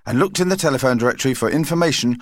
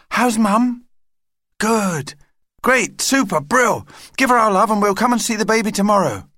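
high, quick bursts of delight; excited child; 9 semitones higher than usual. Acted. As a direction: gush happily down the phone. Child takes phone, thrilled about baby.

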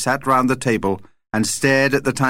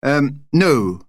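plain reading voice

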